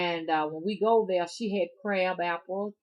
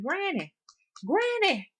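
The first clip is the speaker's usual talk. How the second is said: granny's high voice; 13 semitones higher, 6 dB louder than usual. Retold or imitated. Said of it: "kids pestering granny".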